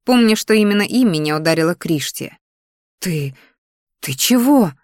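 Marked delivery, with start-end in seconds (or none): 3.0-4.7 falter, then blurt it in alarm; taken aback. A narrator's reading. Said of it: "побелела лицом Дионика".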